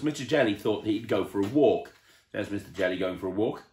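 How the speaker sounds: usual speaking voice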